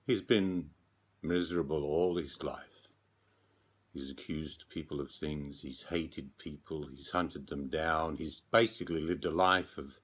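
plain reading voice

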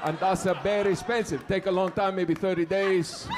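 put-on heavy accent with broken English; building super's foreign accent. Super explains a broken toilet to tenant, thick foreign accent. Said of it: "He's explaining all this technical stuff".

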